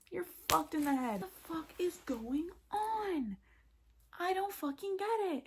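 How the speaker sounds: high, stretched-out wail of disbelief; exasperated outrage. Acted. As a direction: rant in a high, drawn-out voice, utterly baffled. Acted outrage at Evan continues.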